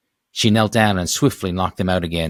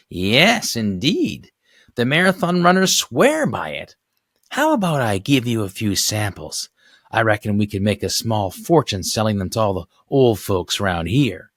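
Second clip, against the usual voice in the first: put-on chirpy old neighbor; 3 semitones higher than usual. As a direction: sound like a nosy elderly saleswoman. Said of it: "Sobalski pitching samples".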